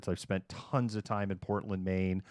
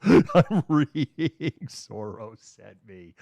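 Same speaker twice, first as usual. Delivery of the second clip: laughing; 8 dB louder, much slower than usual. Real, not acted. Reader cracking up at a viewer's comment, laughing. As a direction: crack up while reading it out slowly.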